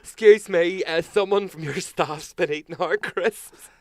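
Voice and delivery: high, loud, slow posh complaint; hammed-up complaint to the hotel reception; mock complaint. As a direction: play the pompous guest at the front desk. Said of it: "Do you want me to go down and complain".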